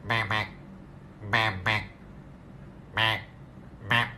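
nose held shut; pinching nose. Acted. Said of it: "bebek tapi hidungnya ditutup rapat".

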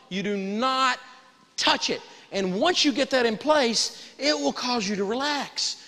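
ordinary delivery

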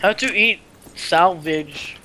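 normal talking voice

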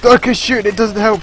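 loud, high, rushed; loud panic. Real, not acted. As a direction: shout it mid-fight. Panicked shooting.

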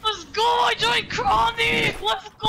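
slow, stretched celebratory yell; drawn-out victory shout. Real, not acted. Winner cheering that he joined Chronic, drawn-out triumphant shout. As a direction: drag out every cheer in triumph. Still celebrating joining the team.

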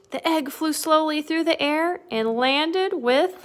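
animated read-aloud climbing in pitch; dramatic storybook narration; suspenseful story reading. A narrator's reading. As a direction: build suspense for little listeners. Reads picture book to children.

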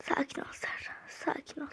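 hushed, telling herself to calm down; whispered self-calming. Real, not acted. Girl calming herself mid-race, whispered. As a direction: breathe it to yourself under your breath.

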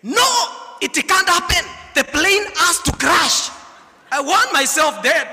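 high, loud, desperate protest; a panicked passenger yelling his refusal; loud desperate cry. Acted. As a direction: shout it like a man who wants the plane to crash. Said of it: "He just stood and spoke loudly".

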